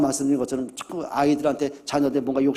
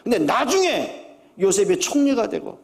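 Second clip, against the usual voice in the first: impassioned preaching; 7 semitones higher than usual. Acted. Pastor pressing his congregation about Jacob's loss, rising fervour. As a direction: build the fervour higher and higher.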